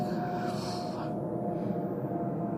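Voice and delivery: a long unvoiced sigh of dread; long weary sigh. Real, not acted. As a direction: let out a tired breath before going on. Dreading the glute work.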